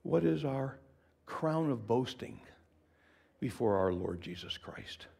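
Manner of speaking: ordinary talk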